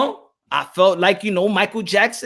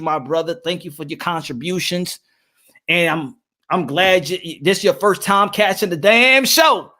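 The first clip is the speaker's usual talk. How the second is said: usual speaking voice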